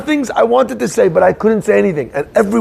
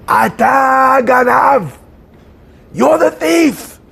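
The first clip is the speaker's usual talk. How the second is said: high, long-drawn accusing yell; retold scream; 6 semitones higher, much slower than usual. Boss screaming at the boy in his nightly memory, retold scream. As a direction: imitate a haunting scream, every word stretched high. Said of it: "with this guy screaming".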